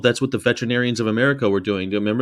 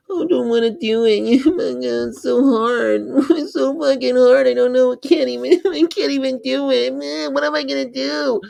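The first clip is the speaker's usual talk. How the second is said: very high, laughing whiny voice; mock whine; 13 semitones higher than usual. Mocking people who say veganism is hard, high whine. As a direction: ham up a helpless complainer. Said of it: "they're like".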